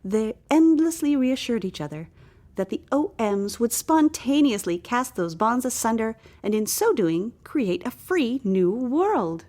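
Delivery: usual speaking voice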